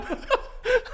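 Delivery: high little laugh. Real, not acted.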